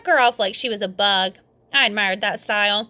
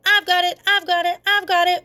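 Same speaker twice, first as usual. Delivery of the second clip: shouting; 8 semitones higher than usual. A narrator's reading. Kids all grabbing the egg, shouting. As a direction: shout it over and over. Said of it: "we shouted".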